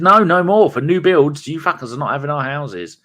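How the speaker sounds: mock-indignant yell; defiant shout. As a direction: shout it back at the buyers. Locals defiantly refusing buyers.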